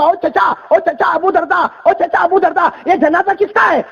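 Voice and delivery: put-on high shouted call to the old man; young man shouting across the street. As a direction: yell out to him, breathless from running. Youth runs up calling uncle.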